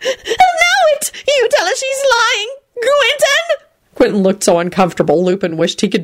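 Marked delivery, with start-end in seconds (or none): 0.0-3.7 tearful wail. A narrator's reading. Loretta tearful after bursting into tears.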